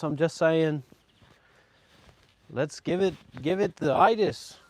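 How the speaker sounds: put-on true blue Aussie accent; hammed-up Australian accent; mock Australian. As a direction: play a laid-back Aussie bloke, broad accent. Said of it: "I sound like a fucking true blue Aussie".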